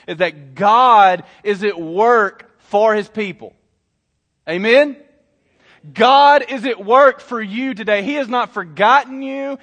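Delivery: slow, forceful preacher's declaration; impassioned sermon delivery; emphatic preaching. Real, not acted. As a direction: drive each promise home to the congregation. Preaches the main message.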